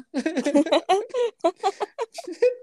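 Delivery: long high-pitched laughing fit; real laugh. Real, not acted. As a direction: crack up high and helplessly. Cracks up during retelling.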